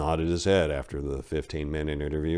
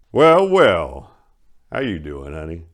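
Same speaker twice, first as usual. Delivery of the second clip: sly greeting; 9 dB louder, slower than usual. Acted. Mayor leering at Maria, loud and sly. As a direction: savour the moment aloud.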